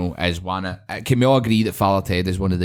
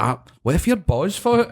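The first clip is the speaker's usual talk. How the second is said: quoting a mate; 8 semitones higher than usual. Retold or imitated. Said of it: "He's like".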